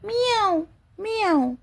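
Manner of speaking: high mewing meow, twice; cat meow. Shown as a demonstration. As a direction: mew like a little cat, pitched high. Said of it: "cat की sound कैसे होती है". (ऊँची आवाज़ में बिल्ली की म्याऊँ)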